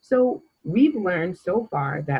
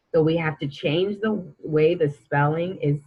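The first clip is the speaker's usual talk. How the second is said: ordinary delivery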